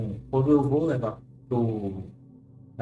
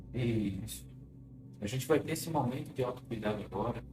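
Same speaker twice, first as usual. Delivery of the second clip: guided hypnosis; 2 semitones lower, 9 dB quieter than usual. Acted. Leads a clinical hypnosis session.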